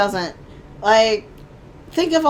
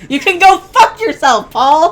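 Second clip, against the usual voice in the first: put-on loud, high comic outburst in British accent; mock outraged shout; 6 semitones higher, 11 dB louder, slower than usual. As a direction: suddenly yell it as a playful insult.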